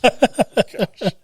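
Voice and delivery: high burst of laughter. Real, not acted. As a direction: laugh out at the punchline. Laughs at his punchline.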